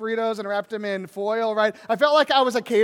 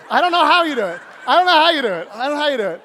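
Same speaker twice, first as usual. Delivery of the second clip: loud, exasperated cry, higher; 4 semitones higher, 8 dB louder, faster than usual. Acted. Father overwhelmed by the twins, exasperated. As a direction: throw up your hands in despair.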